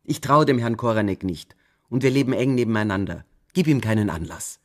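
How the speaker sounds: loud, firm motherly warning; stern warning. A narrator's reading. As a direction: warn her daughter sharply, leaning in. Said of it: "sagte sie". (Mutter warnt die Tochter vor dem Nachbarn, laut und streng)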